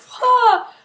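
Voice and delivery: high, loud explosive "pah"; imitated gun blast; mock blast noise. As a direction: burst it out like an energy blast. Gun wave blasts them out.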